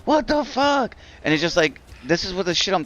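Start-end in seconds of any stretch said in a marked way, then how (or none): 0.0-1.0 high, incredulous mock outburst; the townspeople reacting to the vote, imitated in disbelief; outraged disbelief. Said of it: "everybody was like".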